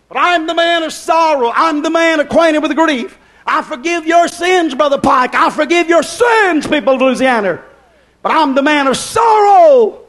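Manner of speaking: high, impassioned proclamation with shouted peaks; full-force preaching. Acted. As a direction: declare it to the whole congregation.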